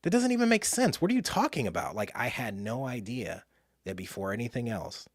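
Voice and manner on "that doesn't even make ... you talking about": high-pitched, exasperated retort; frustrated. Retold or imitated. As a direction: act out indignant disbelief in a much higher voice. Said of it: "I got so frustrated".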